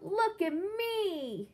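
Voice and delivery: yelled. Acted.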